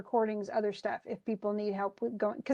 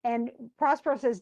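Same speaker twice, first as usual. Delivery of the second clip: ordinary talk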